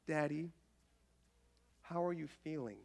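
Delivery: soft, tender little daughter; sweet small voice asking after daddy; tender child voice. Retold or imitated. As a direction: hush it like a caring five-year-old. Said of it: "with the most, like, tender voice".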